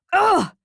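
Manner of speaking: a high, loud cry of frustration; pained grunt of fury; frustrated groan. Acted. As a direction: let out an angry ugh as the fist hits the desk. Nick punches the desk.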